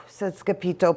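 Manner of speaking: plain reading voice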